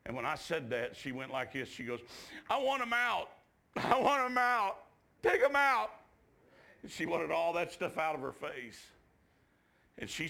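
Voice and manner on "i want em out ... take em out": high, loud outburst; loud high-pitched re-enacted wail; imitated frantic demand. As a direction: act out a girl crying out desperately. Acts the girl demanding piercings removed.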